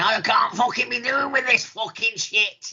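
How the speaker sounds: high, put-on [name] grumble; mock [name] rant. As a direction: ham up a fed-up rock star. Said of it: "he's like this, [name]".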